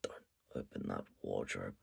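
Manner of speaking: very low, very soft knife-to-throat threat; menacing threat. Retold or imitated. Yamai threatening the guests, very low and quiet. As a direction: growl it low like a blade is at their throat. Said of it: "holding a knife to their fucking throat".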